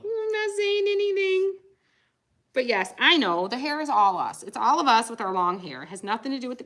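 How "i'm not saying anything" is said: mock husband.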